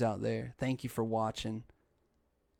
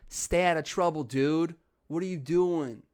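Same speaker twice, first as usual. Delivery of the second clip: mock scolding; 5 semitones higher, 5 dB louder than usual. Mock admonishing aside in the story.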